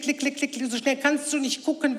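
ordinary talk

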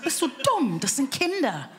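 mock scolding. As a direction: scold the sister in disbelief. Scolding her sister.

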